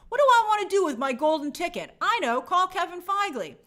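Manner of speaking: high, loud gleeful scheme; lightly voiced actor hatching a plan; mock scheming. Actor imagining his golden ticket call, gleeful.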